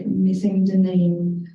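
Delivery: ordinary talk, nothing marked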